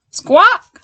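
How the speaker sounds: high loud squawk; parrot squawk. Acted. Parrot grabs Paddington's sandwich, high loud squawk. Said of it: "said a parrot".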